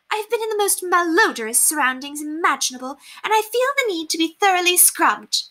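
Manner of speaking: high, affected society-lady voice; haughty lady, exasperated. A narrator's reading. Lady advising the poor on decorating, haughtily exasperated. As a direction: lecture grandly in a high voice. Narrator plays Lady Constance.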